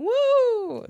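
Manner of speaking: high loud woo; woo howl. Retold or imitated. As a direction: whoop like a crowd. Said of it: "joining in between songs and going".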